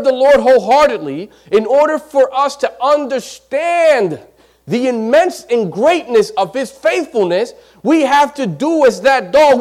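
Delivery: loud, high pulpit preaching; slowed, ringing exhortation; preaching at full force. Acted. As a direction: preach it out with fire. Preacher builds to climax.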